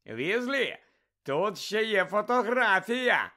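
Malfoy calling out loudly and slowly, gloating; mocking shout. A narrator's reading. Malfoy taunting Ron across the hall, mock shout. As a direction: draw it out with a sneer. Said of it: "вигукнув Мелфой".

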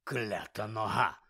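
slow, low, irritated grumble; low slow grumble of annoyance; grumbling angrily. Acted. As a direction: grumble at a bad leg. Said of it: "сердито буркнув він".

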